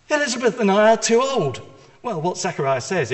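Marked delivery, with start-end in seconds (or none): 0.1-1.6 higher, louder and fast, exasperated disbelief; incredulous protest. Acted. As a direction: blurt it out as if it's absurd.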